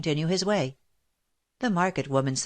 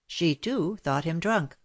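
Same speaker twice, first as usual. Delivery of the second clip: usual speaking voice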